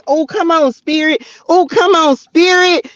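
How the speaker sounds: high, rising "come on, spirit"; 1 excited cry. Real, not acted. Excited praise exclamation.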